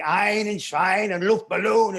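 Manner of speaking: hammed-up German sounds; mock German. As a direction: bark the German like it sounds angry. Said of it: "I thought everybody was angry".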